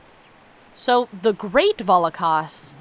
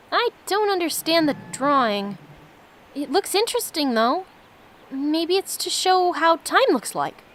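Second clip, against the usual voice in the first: a touch higher, light playful companion voice; Fran's reply; 8 semitones higher than usual. Acted. Streamer voices companion Fran answering him, lightly higher and playful. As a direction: give Fran a slightly lifted, amused read.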